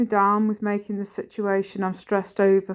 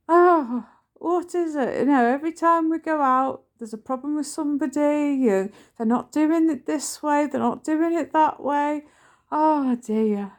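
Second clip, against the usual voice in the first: mock grumbling; 6 semitones higher than usual. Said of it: "he'll be like".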